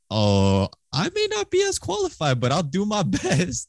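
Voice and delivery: high, modest little voice with a laugh; playful high squeak of humble promise; mock humble self. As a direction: pitch it way up and sound falsely modest. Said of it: "I was like".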